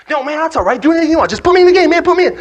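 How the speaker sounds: very high, loud, impatient begging; frantic plea. Acted. Player begging coach to put him in, frantic. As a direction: shout over the explanation, desperate to play.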